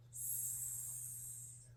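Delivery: "S" hissed softly through teeth; snake hiss. Shown as a demonstration. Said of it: "Like a snake".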